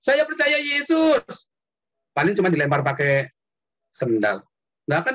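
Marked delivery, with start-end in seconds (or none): 0.0-1.2 high, slow, drawn-out proclamation; mock street shout. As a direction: call it out like preaching at a bus station. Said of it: "teriak di terminal bis".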